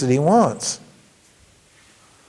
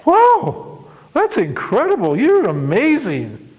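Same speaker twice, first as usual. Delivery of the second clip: gushing flattery; 9 semitones higher, slower than usual. Retold or imitated. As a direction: ham up the amazed praise. Man flattering the genie.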